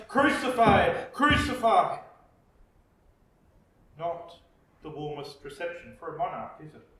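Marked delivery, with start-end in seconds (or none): 0.1-2.0 loud chanted demand, louder; the crowd roaring at Pilate, shouting. Acted. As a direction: call it out like an angry mob.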